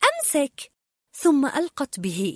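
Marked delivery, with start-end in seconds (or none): 0.0-0.7 high call across to him. A narrator's reading. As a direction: call out a warning. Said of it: "نادت كريستوف قائلة".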